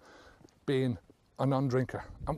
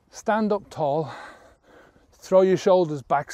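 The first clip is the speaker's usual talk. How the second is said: usual speaking voice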